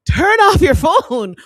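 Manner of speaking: loud, high, drawn-out mock yell; exasperated shout. Former manager retelling advice about late-night calls, exasperated mock shout. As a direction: holler it slowly, acting out exasperation.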